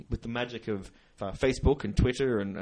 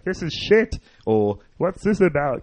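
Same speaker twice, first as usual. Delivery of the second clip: put-on drunk heckler; 6 semitones higher, 9 dB louder, slower than usual. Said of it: "so he was sort of going".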